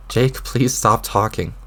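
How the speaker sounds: normal talking voice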